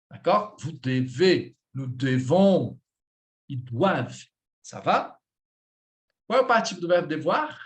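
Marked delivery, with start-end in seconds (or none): 0.6-4.2 low, weight on the ends of "devez", "devons", "doivent"; final-syllable stress. Shown as a demonstration. Teacher runs through the conjugation, stressing final syllables. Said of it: "a última sílaba vai sofrer a entonação".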